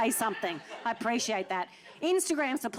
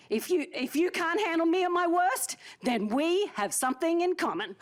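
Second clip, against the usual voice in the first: grand quote reading; 6 semitones higher than usual. Retold or imitated. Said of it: "my version of the Marilyn Monroe quote".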